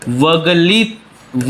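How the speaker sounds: drawn-out syllables, left unfinished; slow dictation. Shown as a demonstration. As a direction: sound the term out very slowly. Teacher sounds out a new term.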